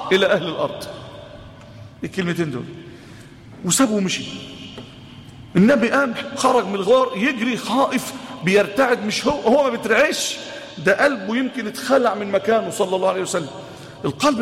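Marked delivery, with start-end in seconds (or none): none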